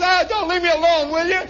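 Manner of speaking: high, loud put-upon plea; whining protest. Acted. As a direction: whine back at the bullies. Shermy protests to the sergeant, acted.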